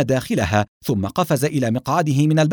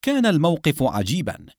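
plain reading voice

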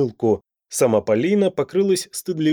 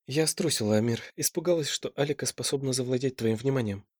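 much softer, hushed admission; soft confession; 8 dB quieter than usual. A narrator's reading. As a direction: confess it quietly. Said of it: "призналась моя богиня".

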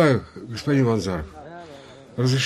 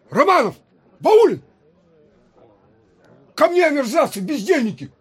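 shouting; 5 dB louder than usual. Acted. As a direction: bellow the names, high and loud. Officer yells for his men.

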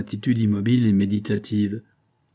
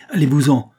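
louder, higher angry threat; exasperated threat; 4 semitones higher, 7 dB louder than usual. A narrator's reading. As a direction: threaten her to get out. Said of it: "reprit la jeune fille exaspérée".

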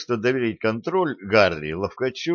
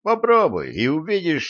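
raised voice; 7 semitones higher than usual. Acted. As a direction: throw the challenge back hard. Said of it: "повысил голос Гарри".

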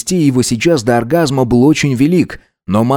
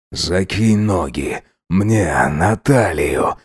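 low, slow, strained voice; husky, slowed-down request; hoarse gasp; 5 semitones lower, 4 dB quieter, much slower than usual. A narrator's reading. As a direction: drop low and drag the words out. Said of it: "прохрипел Максимилиан".

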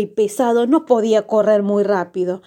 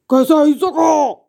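booming giant voice, higher and put-on; thundering giant; 5 semitones higher, 4 dB louder than usual. A narrator's reading. Giant confronts the intruding brothers, thundering voice. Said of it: "le preguntó con voz de trueno".

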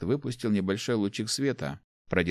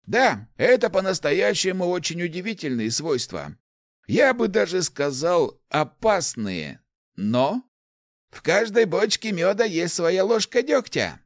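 put-on higher-pitched, animated old man's voice; enthusiastic old inventor; 10 semitones higher, 4 dB louder than usual. Old inventor explains artefacts to the rector, enthusiastic. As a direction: explain your discovery eagerly, pitched up.

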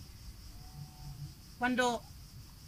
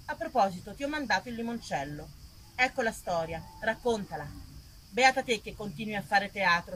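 reading a letter; 4 semitones lower than usual. Acted. Actress reads her friend Marina's letter to the audience, light and intimate. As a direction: read it like a friendly letter from Naples.

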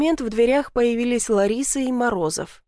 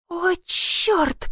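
hissed curse; 8 semitones higher than usual. A narrator's reading. Heroine losing her balance under the window, mildly hissed curse. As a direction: hiss it in sudden alarm. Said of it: "прошипела".

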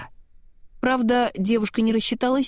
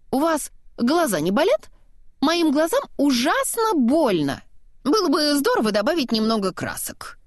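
higher-pitched, theatrical snowman; chatty snowman; 6 semitones higher than usual. A narrator's reading. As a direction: play a talkative snowman, pitched up and stretching key words. Unseen snowman chatters about the view.

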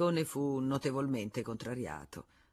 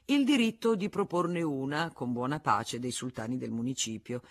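ordinary delivery

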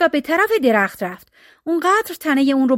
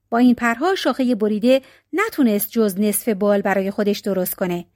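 usual speaking voice

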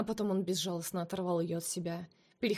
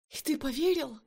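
much higher, louder cry; woman screaming at her lover; 7 semitones higher, 4 dB louder than usual. A narrator's reading. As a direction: cry out in disbelief. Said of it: "закричала она".

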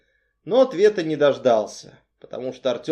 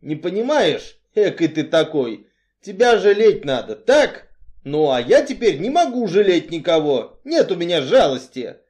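raised, emphatic voice; blunt Artyom, impatient; 4 semitones higher, 6 dB louder than usual. A narrator's reading. Strongman explains he can pity nobody, impatiently. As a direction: insist with growing impatience.

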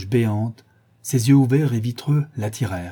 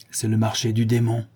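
ordinary delivery

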